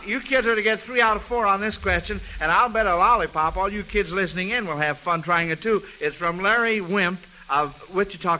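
usual speaking voice

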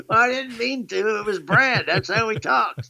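high, lightly Southern mock protest; playful defensive reply to wife. Answering wife about talking like Brad, mock defensive. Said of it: "I said".

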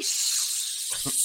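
normal talking voice

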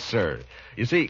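normal talking voice

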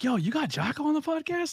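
squeaky mocking impression of a friend; imitating Bougie. Retelling Bougie asking about the podcast guests, in a high put-on voice. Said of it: "Bougie's like".

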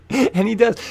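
ordinary delivery

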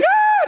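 very high, loud shriek; hammed-up terrified scream; acted scream. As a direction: scream in mock terror. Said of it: "I'm screaming".

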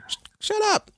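high-pitched mock outburst; acted-out exasperated snap; mock angry retort. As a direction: cut them off in a high, irritated voice. Acting Willow snapping back at Xander.

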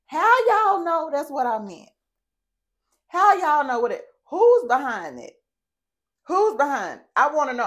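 fed-up indignant questioning; indignant outrage. Real, not acted. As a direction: demand to know who flagged her. Indignant rant at being flagged.

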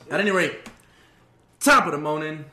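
a cheery fake Irish hello; mock Irish accent. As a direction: top of the morning in a put-on Irish lilt. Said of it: "I thought you was doing a real accent".

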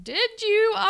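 exaggerated doubtful "did you"; sceptical questioning. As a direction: question her claim with a big jump in pitch. Retorts sceptically to the character's claim.

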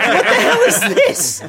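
very high, loud, drawn-out mock outrage; mock shock. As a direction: exclaim in exaggerated disbelief through laughter. Retold shock at topless card.

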